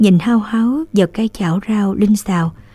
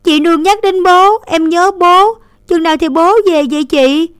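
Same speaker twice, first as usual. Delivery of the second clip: put-on higher, louder, mildly tearful child; sobbing child; 9 semitones higher, 6 dB louder than usual. A narrator's reading. Little boy crying about missing father, tearful. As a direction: ask through mock sobs. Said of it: "thúc thích".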